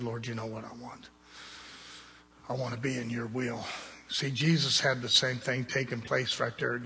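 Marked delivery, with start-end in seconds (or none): 0.0-3.7 very soft, lower prayerful reply; humble submission. Retold or imitated. Young minister yielding to the Lord, humbly and softly. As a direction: retell a quiet surrender.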